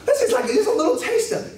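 high-pitched coaxing voice; high, cajoling retold plea. Coaxing wife to try wine.

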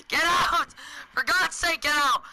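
high, loud panicked shout; screaming. Real, not acted. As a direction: scream desperately to get out. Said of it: "Sorry for screaming that".

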